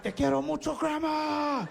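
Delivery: high, strained power-up shout; high desperate yell in Spanish; battle-cry yell. Retold or imitated. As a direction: holler love like a war cry. Yelled love mid-fight, for strength.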